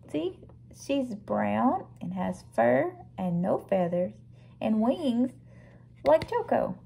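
ordinary delivery

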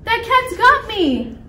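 high, slow mock shriek; hammed-up falsetto distress; Rosie. A narrator's reading. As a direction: wail it as a captured damsel. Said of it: "shrieked Rosie".

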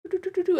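high sung toots like a flute; mock flute toot. Shown as a demonstration. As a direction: play the notes like a little flute. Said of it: "I'm gonna play it like a flute".

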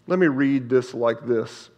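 normal talking voice

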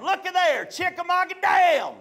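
put-on high, gleeful kid's shout; out loud. Son reading the sign at the lake, out loud. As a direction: call it out high, imitating a cheeky kid. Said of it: "I said it out loud".

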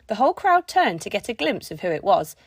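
ordinary delivery, nothing marked

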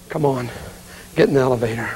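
ordinary talk

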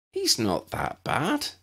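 slow, considered judgement; decisive. A narrator's reading. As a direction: weigh it, then decide. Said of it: "Lydia decided".

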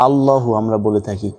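normal talking voice

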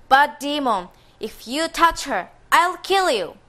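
high, loud warning to the demon; fierce threat. Acted. Boy threatens the ogre.

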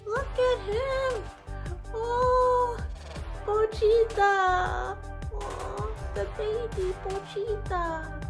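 high, slow, adoring squeal; cutesy cooing. Real, not acted. Cooing over a cute figure.

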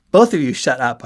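forceful interruption. A narrator's reading. Narrator interrupting his bickering friends, loud and firm. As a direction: cut across them both firmly. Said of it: "I interrupted".

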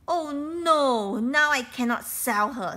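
loud, high dismayed cry; a man's loud dismay as the bird dies; dismayed outcry. Acted. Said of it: "said the man".

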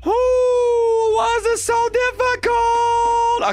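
high, slow, strained cry; frustrated wail. Real, not acted. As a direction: wail it out in exasperation. Cries out at hard game.

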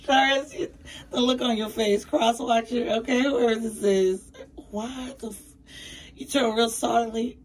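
high and loud, teasing the listener; excited teasing. Acted. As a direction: rise up and tease them. Laughing at an imagined morning after.

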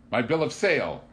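high, loud indignant outburst; outraged protest. A narrator's reading. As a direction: insist on your rights loudly. Furious Torelli demands his paper.

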